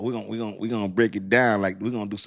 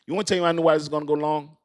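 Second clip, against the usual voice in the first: ordinary delivery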